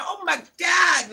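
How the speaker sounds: high, drawn-out oh my God in disbelief; real disbelief; exasperated exclamation. Real, not acted. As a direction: let it ring high and slow. Exasperated reaction to chat.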